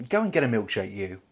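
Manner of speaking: ordinary talk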